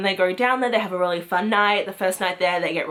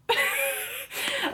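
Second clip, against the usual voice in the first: high-pitched, softer laugh; high giggle; 6 dB quieter than usual. Real, not acted. Reviewer announcing The Cabin, high giggle. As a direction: giggle helplessly before saying the title.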